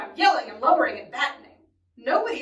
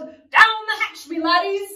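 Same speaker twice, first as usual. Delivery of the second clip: yelling; 8 semitones higher, 5 dB louder than usual. Acted. Pirate captain opens dinner for his crew, yelling. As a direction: bellow it to the dinner table. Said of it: "pounded his fist on the table and yelled".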